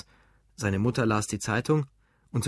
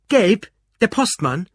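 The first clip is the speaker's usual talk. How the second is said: loud, high, slow incredulous shout; angry disbelief; 9 semitones higher, 9 dB louder, slower than usual. A narrator's reading. Husband ignoring his mother and pressing Ruth, incredulous. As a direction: spell out the outrage loudly. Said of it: "fuhr er fort, ohne seine Mutter zu beachten".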